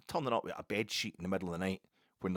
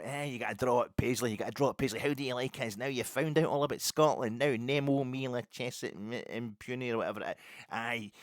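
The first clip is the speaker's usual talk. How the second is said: louder, higher gloating jeer at the visiting player; taunting terrace voice; 5 semitones higher, 5 dB louder than usual. Retold or imitated. Fan mocking an English under sixteens star, loud gloating jeer. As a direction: jeer like a smug home fan rubbing it in.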